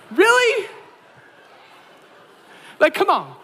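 high, loud incredulous Really; mock-outraged high complaint; exasperated disbelief. Reacting to the huge bag.

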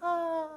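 high, soft imitated distant scream; a little scream from far off; tiny high-pitched "ah", held softly; teeny tiny scream. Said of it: "these teeny tiny little screams in the background".

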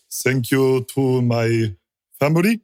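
put-on slow, halting Japanese-accented thanks; a slow Japanese-accented thank-you speech after the race; imitating Tajima's accent. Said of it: "he goes".

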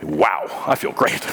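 a low, breathy, dazed sigh of relief; drugged bliss. Retold or imitated. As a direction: play it floaty and dazed from the morphine. High on morphine.

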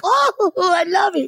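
high, drawn-out mock gushing; gushing fan. Japanese audience loving the ad, high mock gushing. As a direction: squeal it slowly like a smitten fan. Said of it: "In Japan, it's like".

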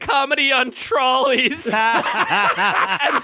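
slow, drawn-out threat through laughter; laughing mock threat. Real, not acted. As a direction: stretch the joke threat out while cracking up.

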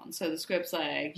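usual speaking voice

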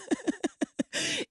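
shrill burst of real laughter; high squealing laugh. Real, not acted. Laughing at the broke college kid.

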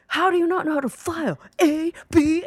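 exasperated, hammed-up movie yell; imitated Nicolas Cage yelling. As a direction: rant like an over-the-top movie actor. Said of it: "yelling at his secretary".

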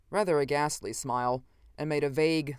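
plain reading voice

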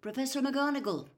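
usual speaking voice

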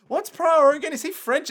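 lightly hammed-up puzzlement, pitched high; mock clueless filmmaker. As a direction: imitate someone baffled, voice shooting up. Mimics clueless makers of the films.

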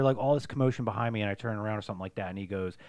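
normal talking voice

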